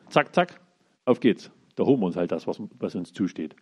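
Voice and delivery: ordinary talk, nothing marked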